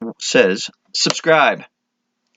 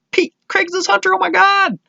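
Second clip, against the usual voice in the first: mock starstruck squeal, much higher; high-pitched mock fan excitement. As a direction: gush in a high fanboy voice, rising as it goes. Retold starstruck thought about Pete.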